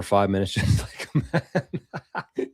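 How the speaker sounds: laughter swallows the last words; breaking up laughing. Real, not acted. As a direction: read it, then crack up laughing. Cracks up reading a comment.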